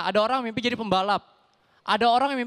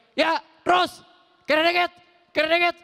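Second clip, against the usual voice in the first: hammed-up parking attendant yelling =188; parking attendant shout; 4 semitones higher than usual. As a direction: yell directions at a car.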